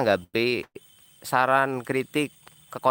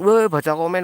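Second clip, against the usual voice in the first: louder, high-pitched mock heckle; mock yelling; 6 semitones higher, 6 dB louder than usual. Streamer imitating viewers demanding he read comments, mock yelling. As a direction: pipe up like a pushy heckler. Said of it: "teriak-teriak".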